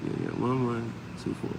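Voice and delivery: normal talking voice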